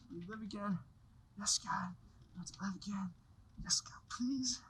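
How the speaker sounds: soft, breathy whispered plea; whispered prayer. Acted. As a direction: pray under your breath. George prays in a whisper.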